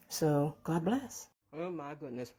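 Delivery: talking with a mouthful of egg; mouth full. Real, not acted. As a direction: say it while chewing, mouth full. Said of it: "Sorry for talking with my mouth full".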